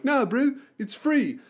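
imitating a Kiwi guy, hammed-up accent; terrible Kiwi accent. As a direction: put on a badly done New Zealand accent. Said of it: "Excuse my terrible fucking Kiwi accent".

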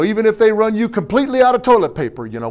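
usual speaking voice

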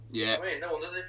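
ordinary delivery

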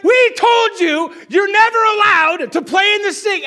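high loud scolding yell; angry shout. Retold or imitated. Parent yells that the sink is off-limits, angry shout. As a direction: lay down the law at full volume. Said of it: "It's like".

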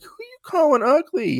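high indignant sass; sassy comeback. Retold or imitated. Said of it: "she's like".

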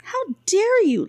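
high, slow outraged retort; shot back, indignant. A narrator's reading. Insulted healer shooting back at the wife, indignant. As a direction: fire back in high-pitched affront. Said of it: "Lapbury shot back".

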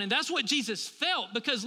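ordinary talk

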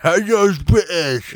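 mocking a British accent. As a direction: drawl it out in a fake Brit voice. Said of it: "you don't go".

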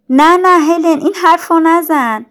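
high, loud, pleading refusal; distressed protest. A narrator's reading. Jane begs dying Helen not to talk so, distressed. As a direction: cry out in alarm, begging her to stop.

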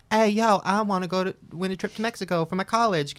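mock a pushy magazine seller at the door; imitated door-to-door seller. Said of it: "these shady looking people sell magazine subscriptions".

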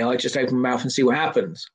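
normal talking voice

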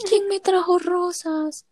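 high, slow mom voice; mom asking. Retold or imitated. Retelling mom's question about the roses, high. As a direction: play mom asking who brought roses. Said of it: "mi mami".

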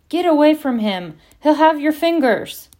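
loud, high-pitched urgent warning; shouted warning. A narrator's reading. Hagrid warning visitors off his hippogriff, a loud shout. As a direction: call out to drive them back.

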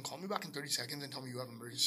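low, quiet, very fast instruction; quick hushed instruction on the phone; hurried request. Retold or imitated. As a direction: rattle it off under your voice. First hurried request to sister.

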